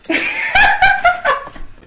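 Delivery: sudden high-pitched laugh; high burst of laughter. Real, not acted. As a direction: let out a loud, bright laugh. Laughs off her own worry.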